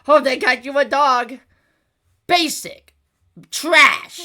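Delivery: high, loud disgust; fed-up and indignant; exasperated. Real, not acted. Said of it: "I'm gonna lose my mind".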